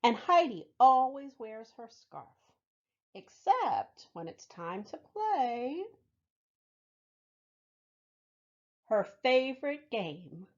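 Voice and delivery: lively storybook read-aloud; reading to children. Acted. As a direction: tell it warmly to a room of kids. Reads picture book to children.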